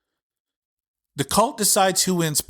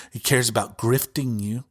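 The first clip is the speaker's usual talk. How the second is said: usual speaking voice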